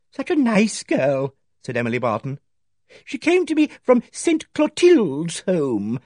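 put-on fond old lady. A narrator's reading. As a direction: speak fondly of a lost servant. Miss Barton remembering her maid.

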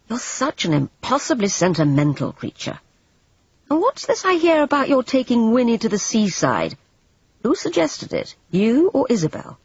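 rising, needling interrogation; impatient Everard. Acted. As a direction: press her, half teasing, half cross.

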